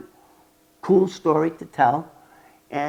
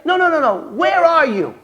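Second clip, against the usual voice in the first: high, louder urgent interruption; urgent agent; 12 semitones higher, 7 dB louder than usual. Retold or imitated. Agent cutting off the informant on the phone, high and urgent. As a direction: cut him off, pressing hard.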